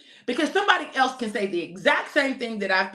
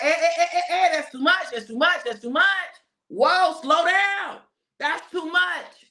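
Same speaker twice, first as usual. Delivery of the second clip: mock refusal; 6 semitones higher than usual. Preacher acts out balking at change, amused mock refusal. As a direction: play yourself balking, half laughing, waving change off. Acting out resisting too much change.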